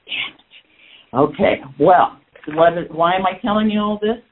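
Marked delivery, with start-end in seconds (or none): 0.1-1.2 breathy whisper of damn it; a soft grudging whispered swear; whispered curse. Real, not acted. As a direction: curse it under your breath, resigned. Grudging curse, mentor's rule again.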